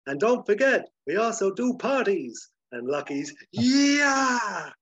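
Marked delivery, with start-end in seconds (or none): 0.1-2.5 high, loud call; shouting after them. Acted. Dusty shouts back into the bar about parties, calling out. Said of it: "Dusty shouts in after them". 3.5-4.7 long, high, loud yell; exultant whoop. Acted. Lucky cheers as the heroes ride off, whooping.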